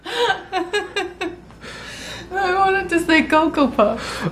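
high, slow laughing whine. Real, not acted. Laughing protest.

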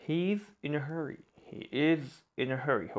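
very slow and careful; very slow dictation. Shown as a demonstration. Teacher reads an example sentence very slowly. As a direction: read it out word by word. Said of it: "slow example sentence".

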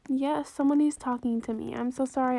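usual speaking voice

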